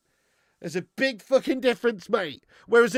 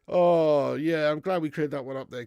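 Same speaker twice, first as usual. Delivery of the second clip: ordinary talk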